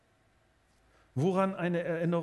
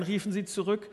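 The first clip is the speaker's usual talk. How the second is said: ordinary delivery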